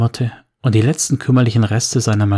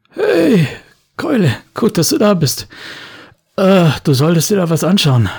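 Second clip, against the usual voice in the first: high, lively buddy voice; Murat's voice; 7 semitones higher, 3 dB louder than usual. Acted. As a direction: greet your pal up high and cheery. Murat greets Thorn.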